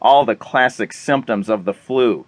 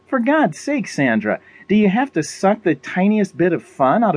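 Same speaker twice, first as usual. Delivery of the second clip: higher-pitched, pleading protest; indignant character voice, pitch jumping up; exasperated Molly; 6 semitones higher than usual. Acted. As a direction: push back at a killjoy friend.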